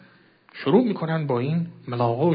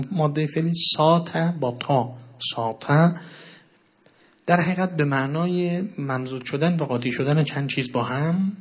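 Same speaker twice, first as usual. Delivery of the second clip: ordinary delivery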